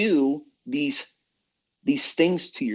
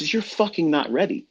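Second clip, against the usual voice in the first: ordinary talk, nothing marked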